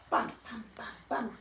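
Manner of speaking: soft bump bump of a dribbled ball; ball bounce imitation. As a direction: bounce the words like a basketball. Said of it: "dribbling his basketball".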